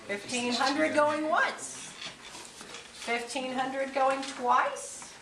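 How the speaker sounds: slow, drawn-out going once, going twice; auctioneer. Pretend. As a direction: stretch out the close like an auctioneer. Closing the auction sale.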